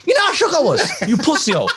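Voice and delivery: high, mock-panicked inner voice; shaken. Replaying his thoughts during the scare, high and shaken. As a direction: squeal it with comic nerves.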